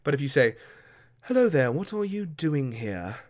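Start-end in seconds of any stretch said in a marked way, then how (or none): 1.2-3.2 noble's British accent, talking down; posh British accent. Shown as a demonstration. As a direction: play British nobility looking down the nose. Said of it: "that might be nobility".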